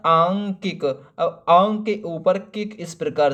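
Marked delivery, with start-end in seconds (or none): 0.1-1.1 आंकिक said slowly, long open आं at the start; slow correct pronunciation. Shown as a demonstration. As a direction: stretch out आंकिक, first vowel long आं. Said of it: "क्या होता है?". (धीरे से, लंबा आं खींचकर "आंकिक")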